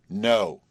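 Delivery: one forceful, interrupting word; emphatic. A narrator's reading. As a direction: cut in with a firm "No". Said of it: "interrupted Marcia emphatically".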